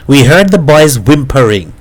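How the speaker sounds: usual speaking voice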